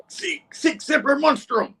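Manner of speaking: very high, slow battle cry; attacking cyborg. Retold or imitated. Cyborg firing his cannon at Norman, high slow war cry. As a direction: draw it out high like a crazed attacker. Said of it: "firing a charged up cannon at him".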